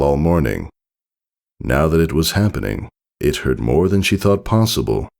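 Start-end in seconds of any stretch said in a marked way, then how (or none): none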